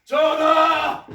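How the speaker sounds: long, loud pleading call; drawn-out cry. Acted. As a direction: stretch the call out loud and slow. Courtier protests in royal council.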